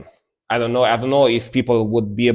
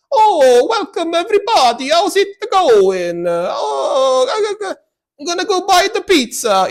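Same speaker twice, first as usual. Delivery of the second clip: fake American-Italian accent; 6 dB louder than usual. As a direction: ham up a cartoon Italian pizza-guy accent, high and loud. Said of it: "the stereotypical American Italian one that goes like".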